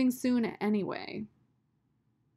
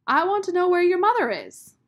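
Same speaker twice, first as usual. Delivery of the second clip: much higher, loud demand; demanding call; 10 semitones higher, 12 dB louder than usual. A narrator's reading. Sistine insists Rob tell her about his mother, loud. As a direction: insist at full voice. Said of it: "Sistine called after him".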